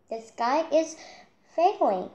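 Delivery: high, loud frantic crying out; panicked cry. Acted. Chick running round the tree, crying out frantically. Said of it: "he cried".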